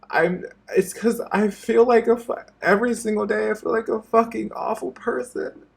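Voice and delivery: real tears rising, straining the voice up; voice breaking, near tears. Real, not acted. YouTuber confessing self-hatred to his viewers, voice cracking with tears. Tearful apology video to viewers.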